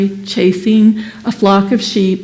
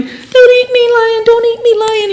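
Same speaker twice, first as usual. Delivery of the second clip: much higher, pleading lion cub voice; terrified lion cub; 13 semitones higher than usual. Acted. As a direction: beg for your life in a tiny high cub voice. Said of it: "just terrified and just, you know, piteously crying".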